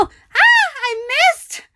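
high yelp, then wail; high scream then whine; frustrated scream. Real, not acted. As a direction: shriek at the miss. Misses jump, screams.

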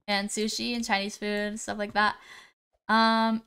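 ordinary delivery

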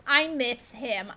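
put-on slow, drawn-out Boston accent. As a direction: lean hard on a put-on Boston accent, stretching each word. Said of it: "gonna talk like that".

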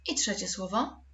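ordinary talk, nothing marked

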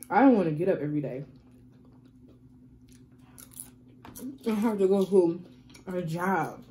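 talking between bites of chips; muffled, lips half closed; mouth full, chewing. Real, not acted.

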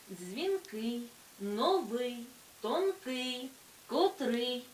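дзвінкий, новий, тонкий, котрий with stressed final syllable; stress on second syllable. Shown as a demonstration. Teacher listing adjectives, дзвінкий to котрий each stressed on the ending. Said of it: "наголос падає на другий склад".

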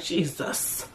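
breathy whisper, almost no voice; whispered Jesus. Retold or imitated. Reacting to the rapper's fall off, hushed whisper. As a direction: let it out under your breath. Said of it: "I was like".

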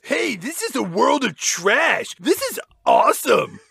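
Milo. Acted. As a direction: marvel like an overexcited kid. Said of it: "Milo actually says".

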